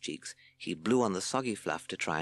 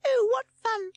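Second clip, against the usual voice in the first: very high, loud squeak; delighted chick; 8 dB louder than usual. A narrator's reading. Admirer amused by Sid's clowning, loud squeak. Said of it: "said the bundle of fluff".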